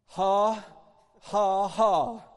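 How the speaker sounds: slow, deliberate put-on ha-ha-ha; forced laugh. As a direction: make each ha a loud, separate fake laugh. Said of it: "He said he just put it on".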